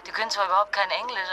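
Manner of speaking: ordinary talk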